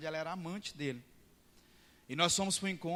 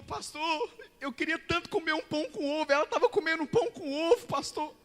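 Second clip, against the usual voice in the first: high, pleading mock whine; begging for some bread and egg; whiny man; 11 semitones higher, 6 dB louder than usual. Hungry disciple moans to the pastor, high and whiny. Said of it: "Ele falou".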